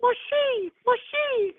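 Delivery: very high squeaky whine; squeaky mock cry. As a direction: squeal the words in mock tears. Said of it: "As he cried, he said".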